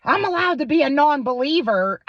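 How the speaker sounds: high, loud whiny mock of him; high, loud mocking imitation of the screaming boyfriend; mocking his yell. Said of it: "this guy would literally fucking scream at me".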